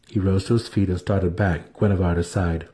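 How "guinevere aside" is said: ordinary delivery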